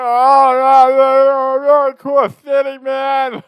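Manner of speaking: high, loud jittery voice; freaked-out tripping. Retold or imitated. Tripping man thinking in his head, high jittery panic. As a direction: ramble like he's losing it. Reacting to mirror while tripping.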